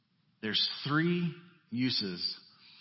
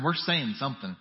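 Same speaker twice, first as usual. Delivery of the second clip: usual speaking voice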